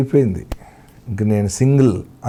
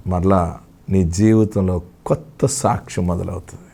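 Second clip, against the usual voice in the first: usual speaking voice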